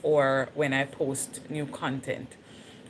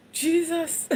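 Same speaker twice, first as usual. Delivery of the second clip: much higher startled cry of Jesus; startled call; 12 semitones higher than usual. Retold or imitated. Showing viewers her usual first call at any noise, high startled cry. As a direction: exclaim it as your usual first reaction. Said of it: "I'm usually like".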